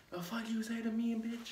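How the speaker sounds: high-pitched mock drunk snarl; drunk guy yelling. Drunk guy squaring up to the friends, mock aggressive. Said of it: "he was like".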